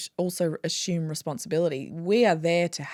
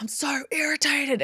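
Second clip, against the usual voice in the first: higher, mildly cross voice of the skin; irritated skin voice; 10 semitones higher than usual. Retold or imitated. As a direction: voice the irritated skin, a touch annoyed. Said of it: "angry voice".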